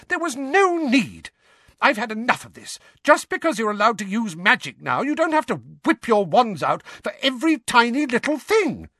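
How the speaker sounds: high-pitched furious scolding; screamed. A narrator's reading. Mother scolding twins for needless magic, screaming. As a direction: tear into them at the top of your register. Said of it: "screamed Missus Weasley".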